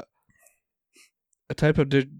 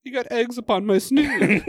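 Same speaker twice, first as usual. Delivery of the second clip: high, laughing complaint; hammed-up period voice; mock aristocrat through laughter; 9 semitones higher than usual. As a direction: fuss about the snood pompously. Period-drama parody continues.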